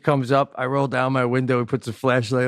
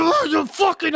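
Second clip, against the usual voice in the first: mechanic cursing. Retold or imitated. Mechanic bangs the drill on the cement, high mock yell. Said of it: "he's banging the drill on the cement".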